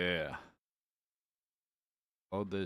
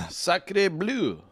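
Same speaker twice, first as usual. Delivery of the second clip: put-on French accent; 9 semitones higher, 7 dB louder than usual. Joking about French accent.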